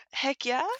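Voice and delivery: puzzled rising "Heck yeah?"; questioning. Pretend. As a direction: ask "Heck yeah" back in disbelief. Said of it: "Don't copy me".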